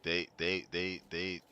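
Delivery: stuck repeating they; stuttering. Real, not acted. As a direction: stutter on the first word. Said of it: "I'm stuttering".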